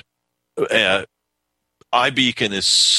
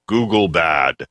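robot voice, shouted; robotic voice; 4 semitones lower than usual. Acted. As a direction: bark it out like a clunky robot. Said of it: "Don't you like his robotic voice?".

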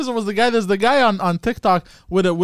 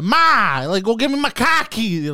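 hammed-up Boston call to Ma; Boston accent; 4 semitones higher, slower than usual. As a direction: call for Ma, Boston r-less "cocky". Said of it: "say words with the accent".

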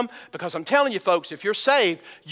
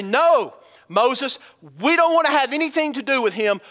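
higher, emphatic mock protest; urgent acted plea, pitched up; acted alarmed refusal; 6 semitones higher, 4 dB louder than usual. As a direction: play a frightened crowd refusing, lightly hammed-up. Acts out terror-struck Israelites refusing.

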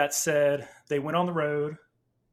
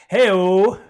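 hey-o call; 5 semitones higher, 12 dB louder than usual. Real, not acted. Cheeky joke punchline.

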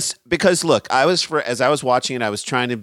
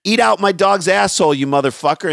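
much higher, louder rude tirade; mock New York insult; 9 semitones higher, 5 dB louder than usual. Cursing the birder out New York style, loud mock rant. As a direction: yell it like a street-corner jerk.

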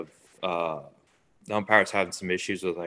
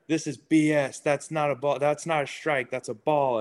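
higher-pitched mock arguing with the umpire; imitated complaint, pitched up; retold protest; 7 semitones higher than usual. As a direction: dispute the call, imitating an indignant player. Player argues a call with umpire.

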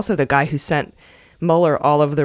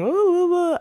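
mock crying; 13 semitones higher than usual. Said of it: "she was crying".

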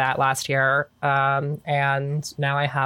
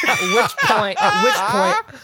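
laughing; 4 dB louder, slower than usual. Real, not acted. Laughing while telling story.

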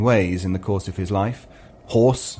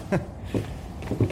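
breathy little laugh; a soft flirty laugh; soft giggle; 9 dB quieter than usual. Acted.

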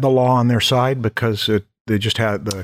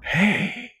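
sexy voice; 8 semitones higher, 5 dB quieter than usual. Acted. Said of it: "you did your sexy voice".

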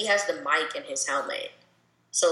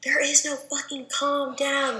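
higher, slow mock yell with every cuss word hit; imitating a furious yell; 9 semitones higher than usual. Retelling a teammate's sideline outburst, higher, slow mock yell. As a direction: mimic the enraged player shouting on the sideline, pitched up and dragged out. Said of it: "he's, like, yelling every cuss word".

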